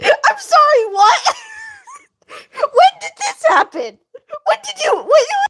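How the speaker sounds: real giggling fit, words squeezed out; laughing hard. Real, not acted. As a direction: laugh through the words till they break. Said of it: "I sound like I'm crying when I'm laughing".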